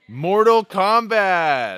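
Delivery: slow, loud announcer boom; booming Mortal Kombat title call; game announcer. Acted. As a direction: drag out the title like an arcade announcer.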